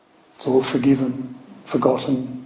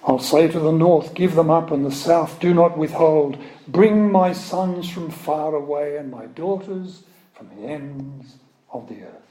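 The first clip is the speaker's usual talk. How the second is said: raised, solemn proclaiming of scripture; scripture reading; 4 semitones higher, 3 dB louder than usual. Acted. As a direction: declaim it like a prophet before a congregation. Reading Isaiah aloud in sermon.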